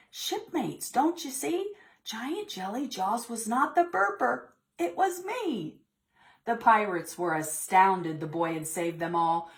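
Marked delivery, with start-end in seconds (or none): 0.1-5.8 put-on little boy voice. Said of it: "Jake's little voice cry".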